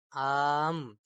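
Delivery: آم with the vowel stretched long and slow; long drawn آم. Shown as a demonstration. Teacher stretching آم for pupils. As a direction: stretch the aa in آم out slowly. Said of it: "اس کو لمبا کھینچ کر کے پڑھا گیا ہے".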